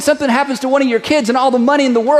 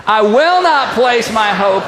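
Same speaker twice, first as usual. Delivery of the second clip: shouted declaration; slower than usual. Shown as a demonstration. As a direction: shout it like you mean it. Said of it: "that was a little tame".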